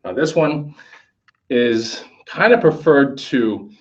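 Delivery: ordinary talk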